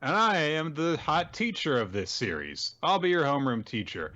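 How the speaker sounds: high, hammed-up teacher voice; theatrical high-pitched teacher; self-introducing teacher. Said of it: "The teacher is like".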